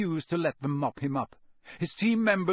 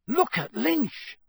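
high, drawn-out urgent yell; Harry yelling; 11 semitones higher, 3 dB louder, slower than usual. A narrator's reading. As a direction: yell to make everyone look. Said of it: "Harry yelled".

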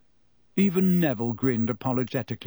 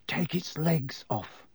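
softer, slow muttering to himself; 5 dB quieter, slower than usual. A narrator's reading. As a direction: mutter it under your breath. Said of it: "Ron was muttering to himself".

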